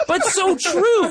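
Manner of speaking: very high, loud, drawn-out exclamation; emphatic agreement. Real, not acted. Podcaster agreeing with the tweet he just read, emphatically. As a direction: stretch it out in a high, delighted squeal of agreement.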